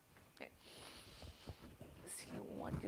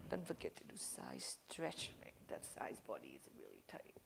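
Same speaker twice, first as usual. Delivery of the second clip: soft whispered reminder; whispering; 9 dB quieter than usual. Real, not acted. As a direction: whisper it under your breath. Aside while class stretches.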